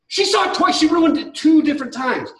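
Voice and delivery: high, indignant outburst; worked-up rant; exasperated complaint. Real, not acted. Exasperated rant about spoiled surprise.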